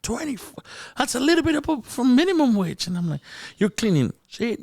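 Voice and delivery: high-pitched, outraged disbelief; incredulous. Retold or imitated. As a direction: squeal it in astonishment at the figure. Retelling his shock at nurse's pay.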